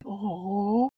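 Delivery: high, drawn-out "oh"; audience's sympathetic aww. Retold or imitated. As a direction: imitate a touched crowd cooing in sympathy. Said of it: "everybody in the audience is like".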